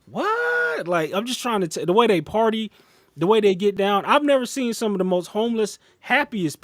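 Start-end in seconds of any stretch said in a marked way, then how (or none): 0.1-0.9 very high stunned what; jaw-drop disbelief in one word; squeaky high stunned what. Retold or imitated. Stunned that the holiday is still coming.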